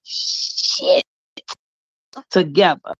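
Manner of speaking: "shit" hissed long, then a pause before "together"; stretched, voiceless "shit" set apart for emphasis. Shown as a demonstration. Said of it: "put emphasis on that word".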